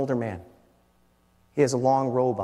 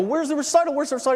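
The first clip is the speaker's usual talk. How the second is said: high, hurried retold question; frantic asking; 14 semitones higher, much faster than usual. Father late for his daughter's recital, frantic asking. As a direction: re-enact a rushed, urgent plea for directions.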